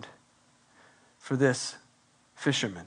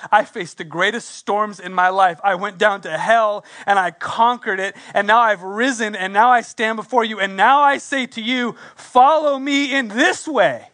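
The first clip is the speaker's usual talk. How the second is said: rising louder and higher to a call; building sermon climax; 6 semitones higher, 5 dB louder than usual. Acted. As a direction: preach it up to the big invitation. Preaching build as Jesus.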